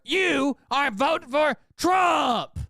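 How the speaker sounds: high, slow, shouted mock donor; shrill mockery of an entitled donor, way up high; whiny donor impression. Streamer mocks donor who demands topics, in a high slow shout. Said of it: "when someone's like".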